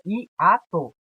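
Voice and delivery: "hiato" slowly as hi, a, to; spaced-out "hiato", syllable by syllable; split into syllables. Shown as a demonstration. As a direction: separate the two vowels in "hiato". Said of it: "separadas na divisão silábica".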